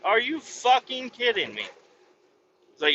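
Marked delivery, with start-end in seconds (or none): 0.0-1.7 high, incredulous outrage; can't believe it, pitched up; disbelief. Retold or imitated. Reacting to the cop who never heard of it, in high disbelief. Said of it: "I'm like".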